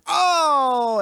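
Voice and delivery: loud high gasp of shock. Shown as a demonstration. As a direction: gasp out an Oh in horror. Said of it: "The difference between going".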